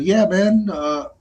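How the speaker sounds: telling the narrator about TikTok earnings, excited and high; friend's voice. Retold or imitated. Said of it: "he goes".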